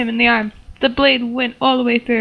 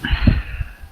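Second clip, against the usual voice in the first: weary sigh. Real, not acted. Exasperated before reading.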